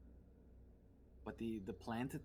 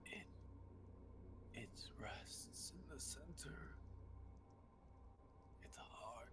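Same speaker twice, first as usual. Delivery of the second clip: eerie whisper; 6 semitones higher, 9 dB quieter than usual. Acted. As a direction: breathe the words like a spirit. Hedge answers in eerie whisper.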